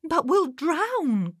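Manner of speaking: put-on high, drawn-out boyish wail; worried young lad crying out; alarmed cry. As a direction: protest in high-pitched dread. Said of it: "cried Nate".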